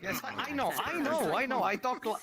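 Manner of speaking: ordinary talk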